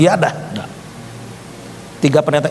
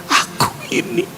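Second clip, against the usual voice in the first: tearful imitation; 8 semitones higher than usual. Man handing over the envelope in tears, crying. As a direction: re-enact a weeping declaration, voice pitched up. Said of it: "Kasih amplop coklat sambil nangis".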